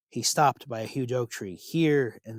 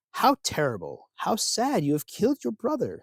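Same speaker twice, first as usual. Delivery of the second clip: higher, hollow feigned pity; mock sorrow; 7 semitones higher than usual. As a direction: pretend to grieve, but enjoy it. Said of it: "But he did not sound sad".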